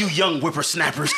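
put-on crotchety old-timer scolding kids; old man voice. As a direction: play a grumpy old prospector. Mocking being old.

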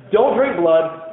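normal talking voice

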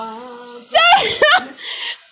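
high shrieking burst of laughter; loud laugh. Real, not acted. Laughing at her own slip, high shriek. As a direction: let out a squealing laugh.

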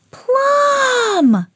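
long, very high scolding call; high-pitched storybook shout. A narrator's reading. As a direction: holler the dog's name, drawn right out. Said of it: "shouted Emma".

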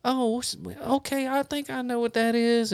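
a hint of a curious older person's voice; old couple. Old couple hears about TikTok, lightly imitated high voice. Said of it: "Old couple comes up behind us".

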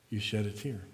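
hushed, grave pulpit voice, letting each phrase settle; solemn, tender preaching. Real, not acted.

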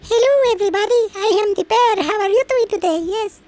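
silly high radio greeting; cheery radio host. Pretend. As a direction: beam out a goofy on-air greeting. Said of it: "It's like".

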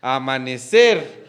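amanecer with the final cer stressed; amane-CEEER. Shown as a demonstration. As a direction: hit the last syllable of amanecer. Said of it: "¿Cuál es la sílaba tónica".

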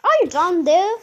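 high, loud call downwards; shouted question. A narrator's reading. Mother peers into the hole, calling out. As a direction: holler it as the anxious mother. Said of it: "shouted Miss Salt".

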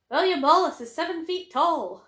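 the wee guy, loud and slow shouting back; mock soldier heckling from the ranks. Cohost playing the doubting soldier, shouted back. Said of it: "the line from the wee guy who shouts back".

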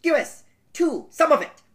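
high, squeaky elf. Acted. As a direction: pipe up like a little elf. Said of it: "The elf said".

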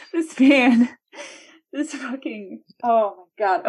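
high, broken exclamations between laughs; real laughter-wrecked exasperation; in pain from laughing. Real, not acted. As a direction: struggle to get words out after cracking up. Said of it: "Rick, I'm in pain".

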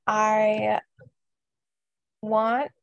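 slow and halting, long gaps between words; talking slowly. Real, not acted. Interviewer reading over notes before a question, slow and halting. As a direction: stall with drawn-out words and pauses while looking over notes. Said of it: "I'm talking so slow".

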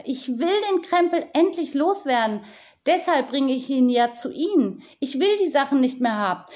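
high, agitated insistence; yelling on. A narrator's reading. Tiziana begs to be rid of the junk, yelling. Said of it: "schrie Tiziana weiter".

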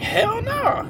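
high-pitched refusal. Retold or imitated. Friend shoots down the marriage question, high-pitched mock refusal. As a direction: imitate a friend's incredulous, very high squeal.

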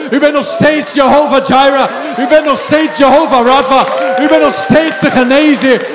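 loud, high, shouted declaration of faith; full-force preaching. Real, not acted. Preacher modelling a believer's prayer to King Jesus, shouted fervently. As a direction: build one proclamation upon another, shouting.

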